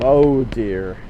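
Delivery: ordinary talk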